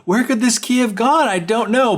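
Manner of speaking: high singsong mock wondering; feigned puzzlement. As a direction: pretend you have no idea. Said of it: "pretending to be looking for it".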